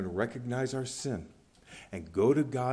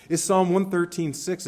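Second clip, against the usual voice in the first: ordinary talk, nothing marked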